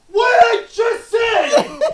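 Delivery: slow, high, loud scream; coach screaming. Retold or imitated. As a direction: scream it up into his face. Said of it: "Screaming in my face".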